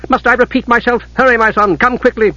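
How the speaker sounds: high-pitched, impatient urging; urgent, impatient. A narrator's reading. Mother hurrying her hesitant son, impatient.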